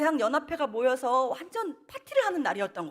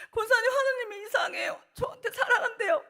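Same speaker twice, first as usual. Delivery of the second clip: high, louder, crying through laughter; 12 semitones higher, 4 dB louder than usual. Retold or imitated. Girl crying to the deaconess in the hallway, laugh-crying. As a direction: sob it out high, half laughing.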